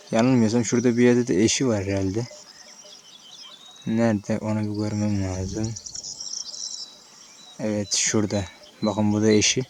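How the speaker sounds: soft, hushed birdwatcher murmur. Real, not acted. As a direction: keep it down so the birds stay. Hushed while watching birds.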